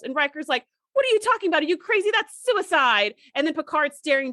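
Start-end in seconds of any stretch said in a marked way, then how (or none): 0.9-3.1 high disbelieving protest; incredulous. Retold or imitated. Riker protesting Data's order, high and incredulous.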